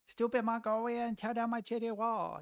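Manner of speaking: a cartoonish hint of a Chinese accent; South Park line quoted to chat in a mild mock Chinese accent. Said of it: "I shouldn't have done the, the accent".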